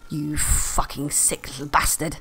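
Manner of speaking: low, slow, soft contempt; quiet, deliberate disgust. Real, not acted. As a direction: drop low and draw out the insult. Disgusted insult after the rant.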